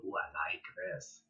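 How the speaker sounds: hushed, soft praise for a calm dog; whispered-soft approval to a calm dog. Shown as a demonstration. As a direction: coo it quietly like a reward. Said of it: "reward that calm behavior".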